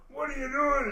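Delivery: sudden high shouted demand; angry yell. Retold or imitated. Drunk roommate yelling at him over smoking pan, angry shout.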